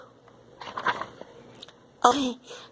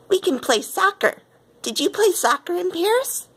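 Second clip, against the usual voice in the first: eager invitation; 10 semitones higher than usual. Acted. Eager invitation to new friend.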